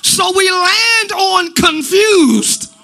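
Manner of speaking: shouted, tearful preaching climb; preaching with a cry. Acted. Pastor crying out against pride to the church. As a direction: cry it out to the congregation.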